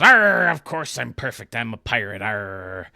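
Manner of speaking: a hint of a gruff pirate, loud on the first arr; light pirate growl for the joke. Telling a pirate joke, the pirate answers in mild pirate voice. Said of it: "And he goes".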